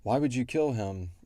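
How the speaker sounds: normal talking voice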